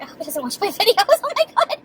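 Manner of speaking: rising to a high excited squeal. Retold or imitated. Recalling the cute cashier noticing her, squealing excitedly. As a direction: gush like a starstruck fan. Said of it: "And I was like".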